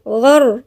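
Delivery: غر with an emphatic, thick ra; isolated heavy غر. Shown as a demonstration. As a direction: demo of the emphatic ر in غر. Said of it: "والراء مفخمة".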